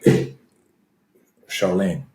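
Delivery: put-on a little French accent on Charlene; slight French accent. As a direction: Charlene said softly with a French lilt. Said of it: "said that with a little French accent".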